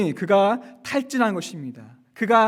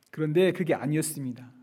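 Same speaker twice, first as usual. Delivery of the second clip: ordinary talk, nothing marked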